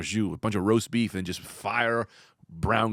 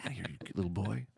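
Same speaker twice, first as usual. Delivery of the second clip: soft, hushed seductive bouncer; a hint of sultry whisper; sexy voice; 11 dB quieter than usual. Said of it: "You're doing a sexy voice".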